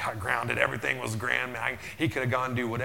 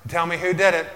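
loud, stern fatherly demand, higher; 6 semitones higher, 11 dB louder than usual. Retold or imitated. Father at dinner demanding which child did it, loud and stern. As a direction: bark it at the kids around the table.

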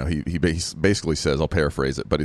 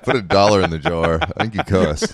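teasing laugh; 4 semitones higher, 4 dB louder than usual. Real, not acted. Teasing about cussing.

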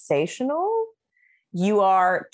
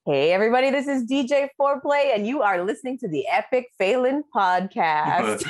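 club DJ station ID; punchy, performed DJ announcement; beefed-up DJ voice; 3 semitones higher than usual. Shown as a demonstration. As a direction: beef up the voice to introduce the show. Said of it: "beef up that voice".

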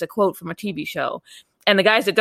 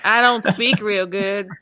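Virginia twang; slower than usual. Retold or imitated. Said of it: "that country Virginia twang".

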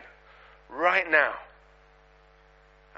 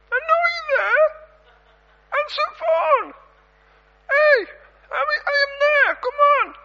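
very high tinny phone voice calling out; high, louder tinny caller voice; 5 dB louder than usual. Retold or imitated. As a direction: squeak it like a voice through a phone speaker. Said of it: "I hear this voice going".

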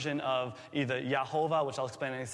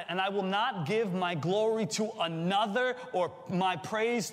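slow, higher, declaiming scripture; solemn slow scripture reading; voicing God; 4 semitones higher, slower than usual. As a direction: proclaim it as God speaking.

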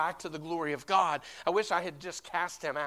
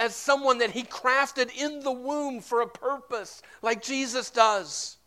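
higher, louder, drawn-out stressed words; impassioned preaching; 6 semitones higher, 7 dB louder than usual. Real, not acted. Pastor urging the church to minister life, impassioned preaching. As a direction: preach it with rising fervor.

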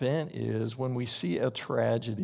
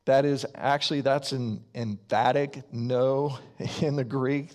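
usual speaking voice

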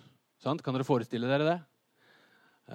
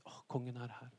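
whispered sigh of safety; feeling safe; 14 dB quieter than usual. Acted. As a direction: breathe it out very softly, reassured. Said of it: "Det blir trygghet". (trygg, lettet hvisking)

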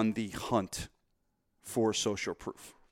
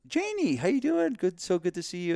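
squealing-high, delighted hello; very high-pitched mock gushing; excited greeting; 9 semitones higher than usual. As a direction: greet a long-lost friend, hammed up. Acted friend greeting Janie.

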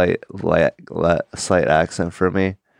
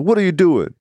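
sharp cop question; 11 semitones higher than usual. Retold or imitated. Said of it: "he's like".

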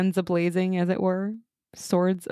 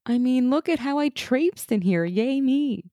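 higher, sarcastic boasting; mock self-praise; 7 semitones higher than usual. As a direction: parody a smug show-off. Mockingly voicing Arthur praising himself.

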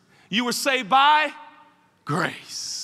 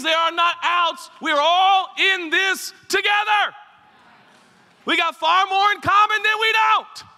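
preaching at full force; 6 semitones higher, 5 dB louder than usual. Real, not acted. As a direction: rise up and preach it to the back pews. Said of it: "I'm gonna start preaching in a second".

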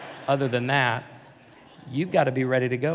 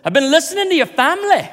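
high, loud Irish woman voice; hammed-up tipsy Irish lady; drunk Irish lady accent; 11 dB louder than usual. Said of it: "if my accent goes from Ireland to Australia to Boston".

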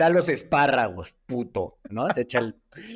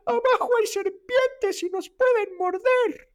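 put-on much higher, slow girl's warning; a girl warns the group about snakes, much higher and slow; much slower than usual. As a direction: imitate a frightened little girl. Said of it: "se echa una niña".

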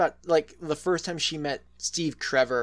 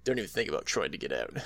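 creepy little voice; 10 semitones lower, 9 dB quieter, faster than usual. Retold or imitated. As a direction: menace him quietly and fast. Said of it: "creepy little voice".

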